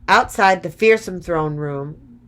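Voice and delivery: ordinary delivery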